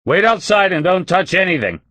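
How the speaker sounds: loud barked order; roaring. A narrator's reading. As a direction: roar the command, scared. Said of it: "his voice sounded like a roar".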